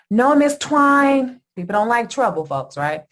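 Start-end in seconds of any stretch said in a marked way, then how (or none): 0.1-1.4 placating clerk. Retold or imitated. Staff hastily placating angry client.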